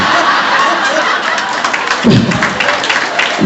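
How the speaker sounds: high breathy burst of laughter; real unvoiced laugh. Real, not acted. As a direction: laugh out at the memory. Laughs at his own story.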